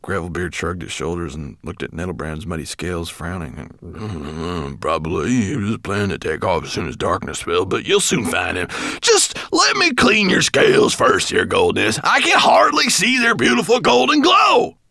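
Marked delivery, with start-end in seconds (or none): 3.8-14.8 flattering dwarf. Acted.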